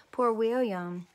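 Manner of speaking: plain reading voice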